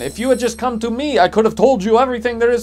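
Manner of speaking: loud mock German commenter with a fake German accent; terrible German accent. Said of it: "Sorry for the terrible German accent".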